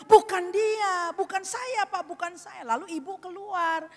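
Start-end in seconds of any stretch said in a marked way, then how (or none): 0.1-2.6 high mock whine, fading; retold tearful denial. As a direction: imitate a crying child pleading innocence. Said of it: "dia jadinya nangis".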